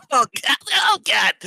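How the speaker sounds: high, loud cries of pain; wailing fallen gnome. Acted. Gnome who fell thirty feet, high loud wailing. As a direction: wail like a little gnome hitting the ground.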